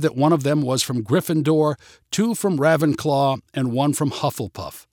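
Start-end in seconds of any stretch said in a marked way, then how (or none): none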